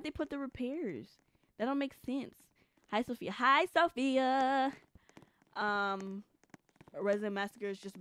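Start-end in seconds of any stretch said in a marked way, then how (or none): 3.3-4.7 slow, lingering greeting with long vowels; drawn-out greeting. Real, not acted. Streamer greets Sophia in chat, drawn-out. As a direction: stretch out the hello. Greeting a viewer a second time.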